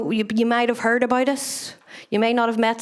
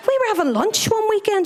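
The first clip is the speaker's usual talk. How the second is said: ordinary delivery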